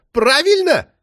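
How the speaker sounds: very high, loud indignant shriek; outraged squawk down the phone; screeching. A narrator's reading. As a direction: screech it back in disbelief. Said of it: "Трубка заверещала так".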